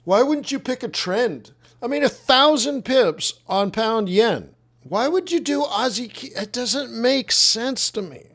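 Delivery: high-pitched, baffled protest; real exasperation. Real, not acted. Baffled rant at trader's choice.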